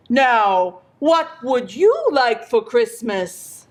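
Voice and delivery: lightly voiced kindly gift-giver; a hint of a jolly old figure, asking; Santa Claus. A narrator's reading. Asking the child on his knee, lightly voiced Santa. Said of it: "Santa's knee and he asked".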